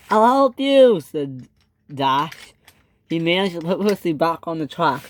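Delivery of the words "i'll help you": high, loud offer of help; calling out. A narrator's reading. Dash to Percy in trouble, calling out. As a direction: call it out urgently.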